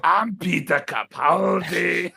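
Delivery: loud, drawn-out mock grand entrance; Peter Capaldi. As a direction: bellow the name slowly, hammed up. Announces himself as visitor at door.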